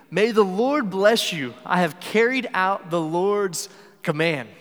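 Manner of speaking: loud, bright-spirited greeting, a bit slow; cheerful greeting. A narrator's reading. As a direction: greet him full of pride and cheer. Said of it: "Saul greeted him cheerfully".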